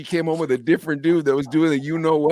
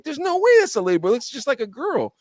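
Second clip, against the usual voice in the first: high, quick retold protest; disbelieving protest; 9 semitones higher than usual. Arguing with the guys at the shop, disbelieving. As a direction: argue it in a high, incredulous pitch. Retelling his argument at the shop.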